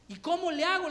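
put-on loud, worried child's question; boy asking mom. Boy asking his mother about his liver, anxious and loud. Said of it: "le decía yo".

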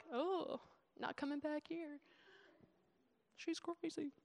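slow, hushed mock aside; muttered reaction. Put-off churchgoer muttering about the preacher, hushed. Imagined congregation reacting to her.

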